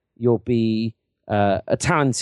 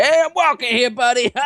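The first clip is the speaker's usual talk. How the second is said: very high, hammed-up New York yelp; mock a bad New York accent, breaking into laughter; 10 semitones higher, 4 dB louder than usual. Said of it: "how you sound to me".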